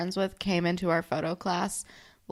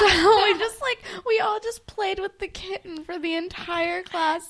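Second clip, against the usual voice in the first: high, excited gushing over a tiny kitten; 10 semitones higher than usual. Real, not acted. Friend recalls a kitten in class, high excited gushing. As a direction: coo about the sweet little kitten, pitched way up.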